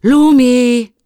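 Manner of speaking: loud, high, drawn greeting; delighted call. Acted. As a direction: call the name out to a friend. Boar boy greets Lumi. (lauter, hoher Begrüßungsruf)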